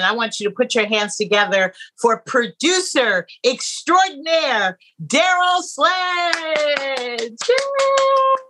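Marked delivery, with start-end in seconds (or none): 2.0-7.3 big host intro, name stretched out; grand entrance announcement. Acted. 7.5-8.4 high, loud cheer; excited cheer. Real, not acted. Host cheers guest's entrance.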